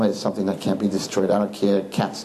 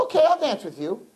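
reluctant agreement; 11 semitones higher than usual. Retold or imitated. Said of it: "He says".

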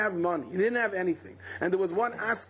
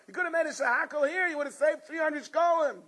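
higher, half-shouted scolding; wife teasing; 6 semitones higher than usual. Retold or imitated. Wife scolds her husband about the taxi fare, higher. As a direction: needle him about the money. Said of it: "His wife said".

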